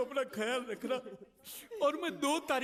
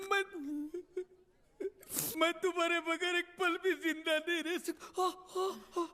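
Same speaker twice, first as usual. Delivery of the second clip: high-pitched, sobbing lament; tearful pleading; 3 semitones higher than usual. Acted. Parting from a beloved nephew left as security, tearful. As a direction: plead through exaggerated sobs.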